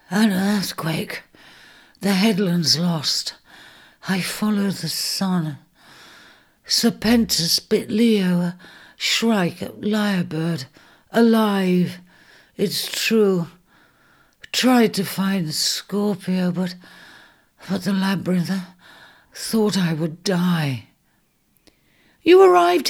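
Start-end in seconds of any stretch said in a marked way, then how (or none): 0.1-12.0 slow, broken phrases from an exhausted ram; barely able to get the words out; faltering ram. Acted. Ares staggers through his story. 14.5-20.9 slow, broken words fading to a whisper; a dying ram's last gasp of a story; faltering then whispered. Acted. Ares faltering, ends in whisper.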